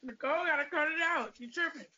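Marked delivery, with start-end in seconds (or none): none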